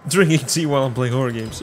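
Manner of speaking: ordinary delivery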